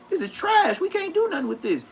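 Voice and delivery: put-on high, scornful voice for the older guy; mocking the older guy. Older guy scolding the new car thief, scornful. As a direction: sneer at the junk like the expert did. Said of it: "He was like".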